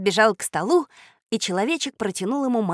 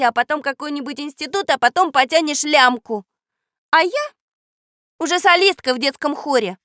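put-on high, loud, boastful girl's tirade; scornful telling-off; 6 semitones higher, 6 dB louder than usual. A narrator's reading. Girl boasts and accuses boy of lying, scornful. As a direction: lecture him cuttingly, pitched up. Katya scornfully tells Vitya off.

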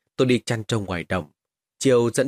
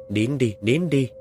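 slow, gentle hushing; calm the frightened child; soothing; slower than usual. Acted. Mother soothing crying son. (dỗ dành chậm rãi)

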